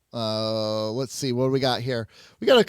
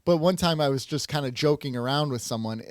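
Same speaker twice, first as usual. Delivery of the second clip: normal talking voice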